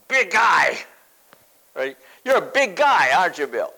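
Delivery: big-voiced tease for little Billy; playful booming. Real, not acted. Teasing a boy in the congregation.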